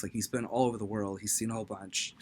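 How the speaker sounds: usual speaking voice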